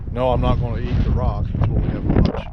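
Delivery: ordinary talk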